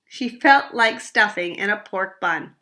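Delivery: usual speaking voice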